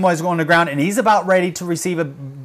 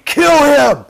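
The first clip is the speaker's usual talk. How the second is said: high, loud retold shout; drawn-out mimicked cry for blood; imitated furious yell; 10 semitones higher, 6 dB louder than usual. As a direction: yell it like the man behind the phone. Voices man egging on attack.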